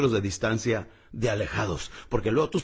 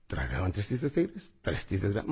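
soft repeat; 5 semitones lower, 7 dB quieter than usual. Shown as a demonstration. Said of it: "Repítelo ahorita mismo".

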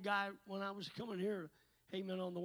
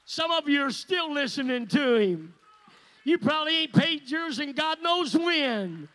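higher and louder, driving rebuke; preaching at full force; 6 semitones higher, 15 dB louder than usual. Real, not acted. Preacher rebukes non-tithers, higher and shouting. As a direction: hammer it home from the pulpit.

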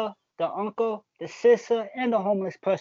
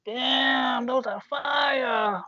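drawn-out, higher-pitched mock amazement; imitated admiring crowd; 6 semitones higher, much slower than usual. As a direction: stretch it out like impressed onlookers hyping sneakers. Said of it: "people was like".

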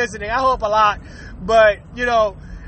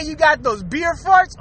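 usual speaking voice

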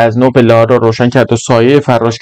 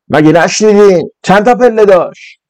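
higher, insistent repeated demand; principal shouting; 7 semitones higher than usual. Acted. Principal repeats his question to the boys, shouting higher. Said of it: "مدیر دوباره داد زد".

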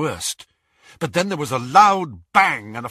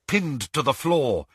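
usual speaking voice

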